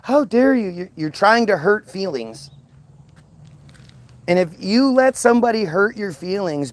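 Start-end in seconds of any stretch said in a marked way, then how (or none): 0.0-0.7 higher, hammed-up offended gasp; sarcastic fake outrage; mock indignation. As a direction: play the pearl-clutcher, mocking. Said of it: "That's this, this really".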